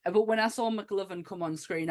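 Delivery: normal talking voice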